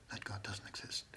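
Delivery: whisper. Real, not acted. Denying the TV preachers' God in a whisper. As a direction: drop to a secret-telling whisper. Repeating denial.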